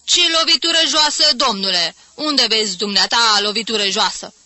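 loud indignant retort; indignant spectator arguing back at full voice; stung outrage. A narrator's reading. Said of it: "sări ars".

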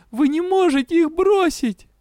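very high-pitched anguished plea; desperate cry. A narrator's reading. Patient begging them not to abandon the others, desperate cry. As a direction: cry it out high, as if with all your strength. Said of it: "кричу изо всех сил".

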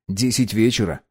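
ordinary delivery

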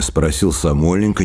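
ordinary delivery, nothing marked

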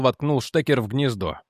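ordinary delivery

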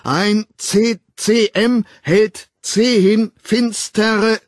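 high, loud, jolted recitation; desperate attempt. A narrator's reading. Woman reciting while being shaken, desperate attempt. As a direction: force out each word separately, very slowly. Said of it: "versuchte sie es".

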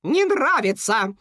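high, drawn-out firm rebuff; cutting refusal. A narrator's reading. Cuckoo rejecting the beaver's offer, curt and firm. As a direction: cut the seller off. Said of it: "отрезала Кука".

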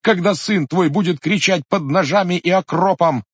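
high, bitter, tearful reproach; plead, then accuse him in despair; wife begging her husband to spare her father; desperate pleading wife. Acted. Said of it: "закричала в отчаянии".

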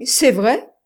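loud, high, disbelieving question; incredulous. A narrator's reading. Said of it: "dit l'autre, incrédule".

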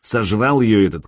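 usual speaking voice

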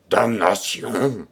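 slow, drawn-out curse in rage; furious cry. A narrator's reading. Renardino cursing his opponent, slow furious cry. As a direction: spit the curse out, foaming with rage. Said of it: "s'écria-t-il, tout écumant de rage".

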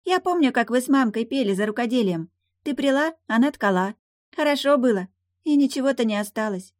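put-on high childish voice, close to tears; little girl remembering, nearly crying; tearful little girl. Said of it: "Девочка всхлипнула".

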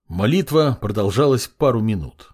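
usual speaking voice

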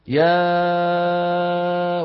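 يا with a very long, steady open aa; vowel from the cavity. Shown as a demonstration. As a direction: hold the aa of يا out from the open mouth cavity. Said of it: "بيقول لك بالتجويف هون". (يا بألف ممدودة طويلة من الجوف)

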